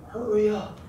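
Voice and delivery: lightly imitated boss barking hurry up; mock impatient boss. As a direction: toss off a mock bossy order. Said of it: "And he didn't just say".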